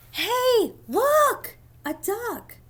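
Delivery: high, loud excited exclaim; excited duck voice. Acted. Duck character spotting the duck, high and excited. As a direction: squeal it like a thrilled kid.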